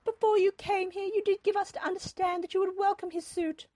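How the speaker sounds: high-pitched, loud accusing complaint; reproachful. A narrator's reading. Mother accusing Olivia of misleading them, reproachful. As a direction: take her to task in a high voice. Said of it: "Mother said reproachfully".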